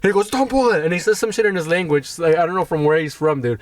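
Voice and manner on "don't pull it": high-pitched imitated warning; in-your-face mock anger. Attendant up close to the customer, mock anger. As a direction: mimic an irate attendant. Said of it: "he fucking gets in my face".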